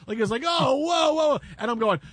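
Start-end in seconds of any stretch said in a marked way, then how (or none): 0.5-1.4 higher, louder startled whoa; shocked outcry. Retold or imitated. Crew in the control room reacting, shocked louder outcry. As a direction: blurt it out in shock.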